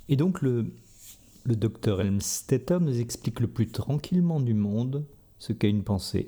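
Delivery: ordinary talk, nothing marked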